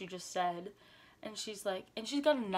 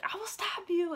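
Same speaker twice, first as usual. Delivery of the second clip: high-pitched mock-fierce threat; mama bear threat; 11 semitones higher, 4 dB louder than usual. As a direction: imitate a protective grandma on the attack. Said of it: "such a mama bear".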